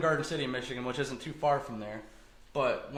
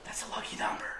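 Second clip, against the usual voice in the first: breathy whisper, voice dropped away; whispered aside; 6 dB quieter than usual. Retold or imitated. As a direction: hush it like a secret. Her comment whispered in retelling.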